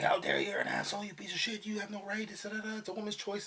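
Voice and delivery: hammed-up furious ranting; mock furious rant. Mimicking an angry commenter cursing her out, mock furious rant. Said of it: "they get super furious".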